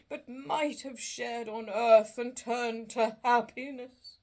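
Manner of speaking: slow, loud, mournful spirit voice; a chained ghost lamenting its fate; doleful ghost. Acted. Marley's ghost lamenting to Scrooge, slow and doleful.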